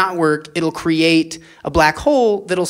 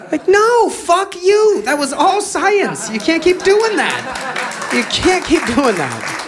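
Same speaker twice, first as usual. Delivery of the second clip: angry rant; 11 semitones higher, slower than usual. Real, not acted. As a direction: blow up, then hammer the words slowly. Comic ranting at believers.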